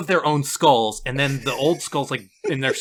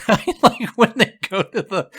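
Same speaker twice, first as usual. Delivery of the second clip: laughing; 8 semitones higher, 4 dB louder than usual. Real, not acted. Laughs while starting the story.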